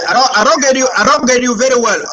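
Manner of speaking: speaking up over a bad line. Real, not acted. Host can't hear caller.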